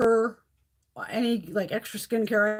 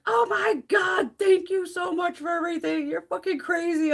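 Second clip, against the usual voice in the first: gushing thanks; 8 semitones higher than usual. Retold or imitated. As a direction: squeal it like an overwhelmed friend. Friend gushing over gifts, retold.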